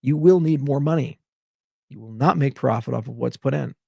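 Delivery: usual speaking voice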